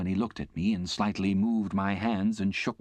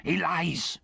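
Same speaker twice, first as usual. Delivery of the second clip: fierce; 9 semitones higher than usual. A narrator's reading. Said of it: "with fierce energy".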